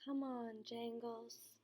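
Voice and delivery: very soft, spent voice; barely above a breath; drained, hushed call to the doll; hushed, numb. A narrator's reading. Grieving boy picks up doll.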